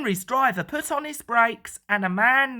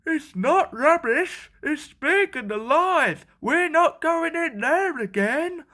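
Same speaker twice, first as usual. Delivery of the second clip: higher, urgent scared voice; insistent frightened workmen; 7 semitones higher than usual. A narrator's reading. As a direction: plead with the foreman, alarmed. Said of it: "frightened workmen".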